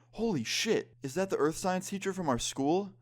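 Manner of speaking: put-on high-pitched shocked drunk friend. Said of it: "the drunk one, say".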